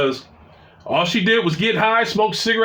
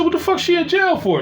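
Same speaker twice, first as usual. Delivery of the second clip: shocked outburst; 9 semitones higher than usual. Retold or imitated. Shocked at sister's news.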